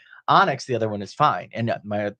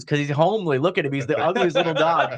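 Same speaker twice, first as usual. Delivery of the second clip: high-pitched fond mockery breaking into laughter; laughing, lighthearted put-down; amused teasing; 5 semitones higher than usual. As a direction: retell it tickled, laughing at the end. Breeder's amused explanation, retold.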